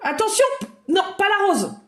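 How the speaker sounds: high-pitched, alarmed call to stop; play-alarm to stop a child; urgent warning. Pretend. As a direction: cry out a sudden high warning.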